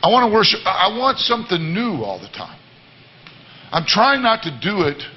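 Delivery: normal talking voice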